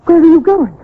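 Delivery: high, loud, anxious call; alarmed cry. Acted. Wife left in the dark calling out in panic. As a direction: call after him in panic. Husband leaves her in the dark.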